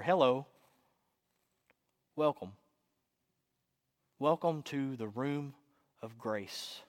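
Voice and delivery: soft, unhurried welcome with long pauses; calm near-whisper. A narrator's reading. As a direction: greet gently, barely above a whisper. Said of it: "so calm and complete, she says, barely above a whisper".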